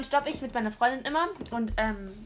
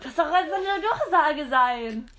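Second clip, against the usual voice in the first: lachend; 3 semitones higher, slower than usual. Real, not acted.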